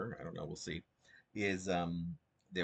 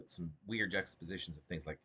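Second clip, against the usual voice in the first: ordinary talk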